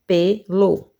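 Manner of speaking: "Pelo" split into pe, lo; two separate beats on "Pelo"; syllable by syllable. Shown as a demonstration. Teacher showing two syllables, "Pelo" broken in two. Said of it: "duas sílabas".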